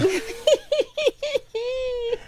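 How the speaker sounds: long high-pitched giggle. Real, not acted. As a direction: giggle at yourself. Laughing at herself.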